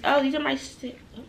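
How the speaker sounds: ordinary talk, nothing marked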